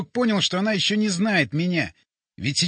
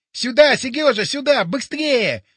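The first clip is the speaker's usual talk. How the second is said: higher, louder call from afar; calling out over the din; 7 semitones higher, 4 dB louder than usual. A narrator's reading. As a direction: shout through a crowd's noise. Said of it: "голос Бори сквозь гвалт".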